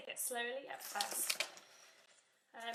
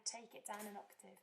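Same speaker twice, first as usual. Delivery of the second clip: ordinary talk, nothing marked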